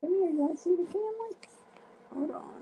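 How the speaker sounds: high fast sing-song dog voice. Real, not acted. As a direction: coo at the puppy. Talking to the dog.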